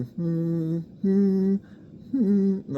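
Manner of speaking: high buzzing hums; demo hums. Shown as a demonstration. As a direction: hum up high to feel the buzz. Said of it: "Like, hmm".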